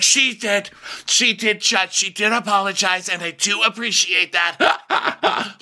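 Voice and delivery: high-pitched caricature, slowing at the end; Brenda. Retold or imitated. Brenda telling the judge she apologized, high caricature. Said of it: "And Brenda's like".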